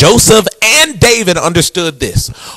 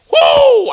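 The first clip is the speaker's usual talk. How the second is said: high preacher's whoop; exclaimed whoop after a bold point. Real, not acted. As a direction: let out a drawn-out high "woo". Whoops after a provocative sermon point.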